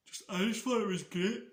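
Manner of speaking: talking through a yawn. Real, not acted. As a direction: yawn the words out. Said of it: "I don't know why I'm yawning so much".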